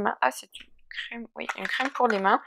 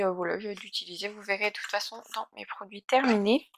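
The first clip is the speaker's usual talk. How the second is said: normal talking voice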